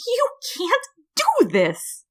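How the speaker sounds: high, slow, drawn-out wail. A narrator's reading. Flower pleads with the human, high and slow. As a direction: stretch the second cry out desperately.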